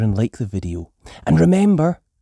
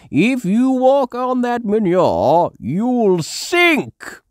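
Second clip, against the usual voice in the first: high, louder warning; Grandpa Pig warning; 13 semitones higher, 6 dB louder, slower than usual. A narrator's reading. Grandpa Pig warning Granny about the manure, high. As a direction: caution her seriously. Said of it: "warns Grandpa Pig".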